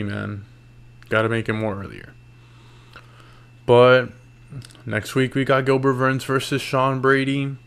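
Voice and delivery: usual speaking voice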